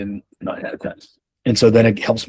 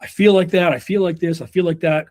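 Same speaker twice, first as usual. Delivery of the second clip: much higher, sing-song wishy-washy mimicry; a playful higher parody of vague feelings; mock vague musing; 8 semitones higher than usual. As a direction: mimic a dithering inner voice. Mimicking vague indecisive thoughts.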